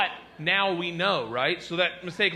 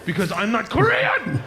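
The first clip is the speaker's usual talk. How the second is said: raised, louder mock indignation; indignant outburst; 4 semitones higher, 6 dB louder than usual. Stranger correcting the traveler's assumption, loud mock indignation. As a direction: burst out in mock offense.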